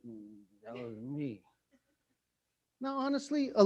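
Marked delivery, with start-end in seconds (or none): 0.1-1.4 much softer, slow sheepish confession; sheepish admission. Retold or imitated. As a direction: own up quietly, a little guilty. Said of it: "I was like".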